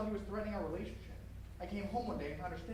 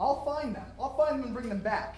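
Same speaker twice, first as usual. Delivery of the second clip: loud, driven threat; furious vow; 4 semitones higher, 11 dB louder than usual. Acted. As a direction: shout the promise at her. James vowing revenge loudly.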